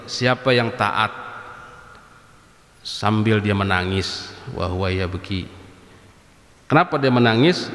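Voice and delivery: slow, low reading of the Arabic text; a slow, measured reading of the scripture with its meaning; kitab recitation. Acted. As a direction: recite it deliberately, like teaching from a kitab.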